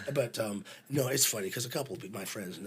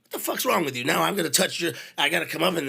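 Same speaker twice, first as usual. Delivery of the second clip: loud, higher mock rant; roadie scolding; 6 semitones higher, 8 dB louder than usual. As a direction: chew him out over the cheese. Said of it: "He goes".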